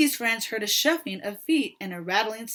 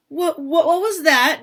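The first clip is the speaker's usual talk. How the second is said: stammering, louder and higher in fright; startled and scared, tripping over the words; a frightened stammered question; frightened gasp; 5 semitones higher, 7 dB louder than usual. Acted. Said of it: "Daisy asked with a gasp".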